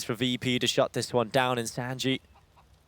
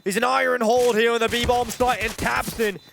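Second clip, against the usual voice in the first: excited shouting; 8 semitones higher than usual. Real, not acted. Caster calling a clutch round.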